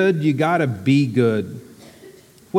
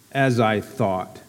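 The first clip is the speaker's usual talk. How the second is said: low, mopey donkey voice, a touch lower than narration; lightly voiced gloomy Eeyore; 5 semitones lower, 3 dB quieter than usual. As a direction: sigh the words out like a glum old donkey.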